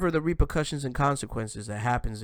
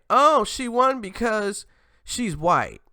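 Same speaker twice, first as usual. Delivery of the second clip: slow, high sneering mimicry; mocking quote; 9 semitones higher, 6 dB louder, slower than usual. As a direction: drawl it out like a whiny critic. Mocking critics' imagined reaction.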